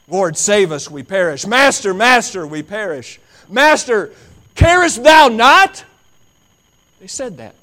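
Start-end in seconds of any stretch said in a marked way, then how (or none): 0.1-5.8 high, loud, stretched plea; frantic pleading cry. Acted. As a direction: cry out in fear of drowning. Disciples' panicked cries.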